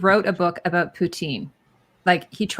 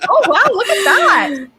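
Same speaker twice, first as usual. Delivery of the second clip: much higher and louder, laughing in amazement; laughing surprise; 12 semitones higher, 8 dB louder, slower than usual. Real, not acted. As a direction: burst out laughing at the glitch. Cheese ball vanishes on green screen.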